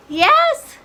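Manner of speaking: put-on high girl puppet voice; high-pitched girlish yes; little girl character. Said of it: "I am the girl".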